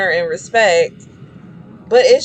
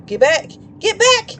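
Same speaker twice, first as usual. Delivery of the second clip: panicked screaming; 11 semitones higher than usual. Real, not acted. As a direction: shriek in real panic at the attacker. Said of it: "I'm sorry for screaming".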